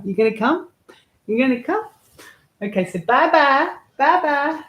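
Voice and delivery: high, lilting voice for a cat; pet talk. Real, not acted. Owner coaxing her cat to come and say goodbye, high pet talk. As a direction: coax the cat in a high sing-song.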